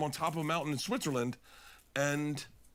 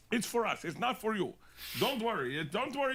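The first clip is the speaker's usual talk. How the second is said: higher-pitched imitation Swiss accent; Swiss guide accent; 8 semitones higher than usual. Swiss guide explaining beacons to a snowboarder, put-on Swiss accent. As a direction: play a Swiss mountain guide with accented English. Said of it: "that's the Switzerland guy".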